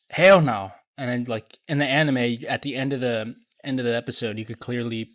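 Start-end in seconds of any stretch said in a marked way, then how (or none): 0.1-0.7 hell no, shouted loud; shouted refusal. Retold or imitated. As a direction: bark the refusal. Kureha scolding Chopper.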